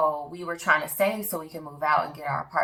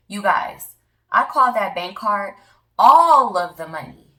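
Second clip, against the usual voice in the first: loud, high burst of excitement; almost yelling the good news; excited shout; 3 semitones higher, 10 dB louder than usual. Real, not acted. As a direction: shout it out, can't believe it. Excited reveal of refunded money.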